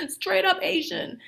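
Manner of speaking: high-pitched, laughing repeat. Real, not acted. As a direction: say it through a real laugh. Amused repeat of slang phrase.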